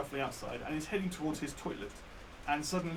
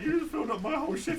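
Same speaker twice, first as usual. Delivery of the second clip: put-on indignant old man; 11 semitones higher than usual. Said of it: "He was just like".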